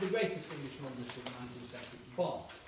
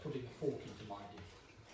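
ordinary delivery

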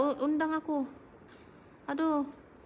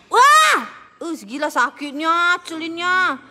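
panicking gamer; 2 semitones higher, 4 dB louder, slower than usual. Real, not acted. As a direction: yell in pain as the hit lands. Taking heavy damage. (teriakan panik keras)

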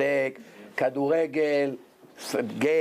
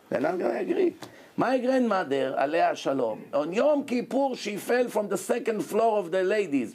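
normal talking voice

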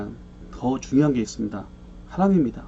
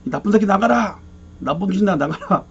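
cursing yell; 6 semitones higher than usual. Retold or imitated. Evangelist cursing the demon to leave, retold angry shout. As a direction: curse the demon out, then run out of steam. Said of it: "그래서 또 욕을 했대요".

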